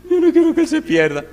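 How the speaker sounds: very high, loud mock wail; hammed-up high-pitched pleading of a wife. Upset wife answers the pastor about her husband, mock wailing plea. Wife's pleading acted again.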